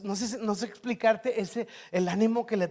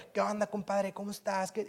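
quiet, relaxed, easygoing chat; just chat softly like you're backstage; casual offstage voice; 10 dB quieter than usual. Retold or imitated. Preacher offstage, casually greeting the pastor. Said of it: "acá abajo".

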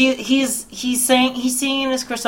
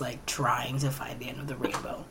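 whisper; 8 semitones lower, 18 dB quieter than usual. Real, not acted. Unvoiced whisper while telling.

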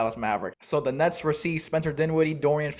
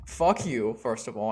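ordinary talk, nothing marked

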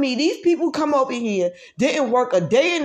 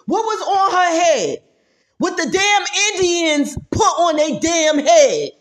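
indignant outburst; 6 semitones higher, 5 dB louder than usual. Real, not acted. Indignant rant about her head.

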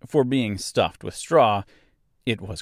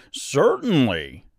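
scarecrow voice; 6 semitones higher, 5 dB louder, slower than usual. A narrator's reading. Scarecrow answers.

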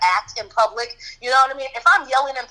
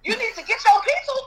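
calling out; 11 semitones higher than usual. Retold or imitated. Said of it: "I said".

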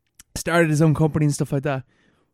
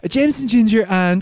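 high, louder, stuck mid-order; flustered ordering; 6 semitones higher, 4 dB louder than usual. Retold or imitated. Flustered at the bar, ordering high and loud. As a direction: push the order out then freeze on and. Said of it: "And I was like".

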